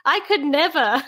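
mock-prim old British lady turning up her nose. Joking about judging teens, a prim old British lady voice. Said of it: "You're old British lady".

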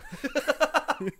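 high-pitched cackle; laughing. Real, not acted. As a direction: crack up at your own bad impression. Cracks up at own impression.

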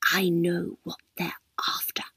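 breathy secret whisper; old lady whispering a secret to her carer. A narrator's reading. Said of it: "Missus Morecambe whispered".

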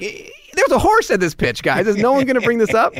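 high, incredulous protest, acted out; exasperated disbelief. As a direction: demand that somebody acknowledge the absurdity. Acts out his protest after pitch.

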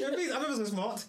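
high, giggly swot voice; teasing high-pitched goody-goody; mock know-it-all. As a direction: play the smug pupil, high and laughing. Continues mocking the know-it-all.

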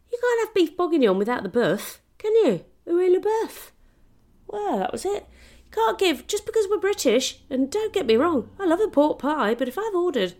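high, loud indignant rant; fuming rant. Retold or imitated. Tourist ranting about pork pie instead of beef, high and loud. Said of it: "Fuming I was".

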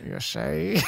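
high Southern "you see?"; southern accent. Acted. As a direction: pitch it up in a playful Southern accent. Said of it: "like, a southern accent".

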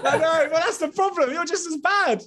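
high giggly teasing; giggling mock outrage. Real, not acted. Laughing teasing back.

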